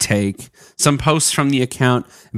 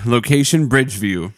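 ordinary talk, nothing marked

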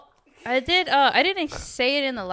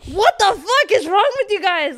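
higher, louder exasperated yell; exasperated outburst; 8 semitones higher, 5 dB louder than usual. Retold or imitated. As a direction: blurt it out fed up and baffled. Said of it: "And I'm just like".

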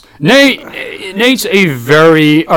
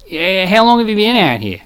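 higher, curious imitated Australian; chatty question in an Australian accent; 5 semitones higher, 3 dB quieter than usual. Customer quizzes supposed countryman about moving, Australian accent. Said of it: "Remember the Australian guy".

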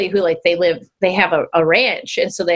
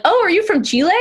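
surprised question; 9 semitones higher, faster than usual. Retold or imitated. Retold question from confused stranger.

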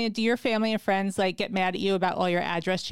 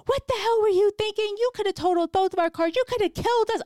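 furious scream; 11 semitones higher than usual. Retold or imitated. Yelling at the woman who stole her parking spot, furious and high. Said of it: "I'm screaming on the top of my lungs".

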